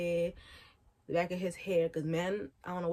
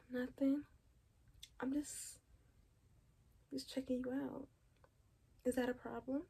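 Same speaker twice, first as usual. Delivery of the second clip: soft, higher, playful come-on; flirty tease; 5 semitones higher, 13 dB quieter than usual. Acted. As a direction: tease him gently, higher and hushed.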